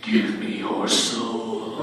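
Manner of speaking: deep, slow demonic growl; low sinister demon voice. Acted. Demon voice after hearing demon laugh.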